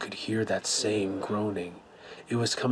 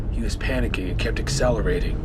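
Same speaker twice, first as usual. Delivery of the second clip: ordinary talk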